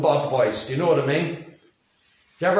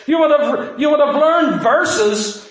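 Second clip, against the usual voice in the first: raised voice; 10 semitones higher, 5 dB louder than usual. Real, not acted. Preacher raising voice.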